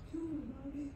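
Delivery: put-on high, hushed child's plea; eerie little voice, high and very soft; Jason's voice. Jason urging his mother, high hushed voice. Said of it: "you hear Jason's voice going".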